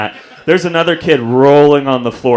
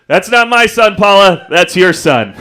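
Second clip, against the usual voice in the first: loud, higher-pitched disowning yell; imitating father's shouted retort; 7 semitones higher, 7 dB louder than usual. Dad topping mom in the gym, loud retort. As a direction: yell back across the gym, passing the blame.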